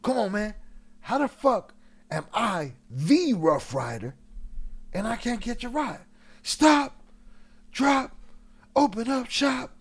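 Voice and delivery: high, loud indignant rant building to a yell; mock outraged shout. X yelling at the road manager to hand over the bike. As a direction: yell it like you can't believe you're refused. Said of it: "he tells dude".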